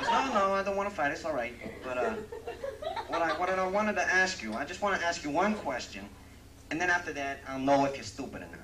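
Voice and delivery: excited New Yorker. Acted. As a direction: back off fast, then pitch the question. New Yorker backs off, then asks.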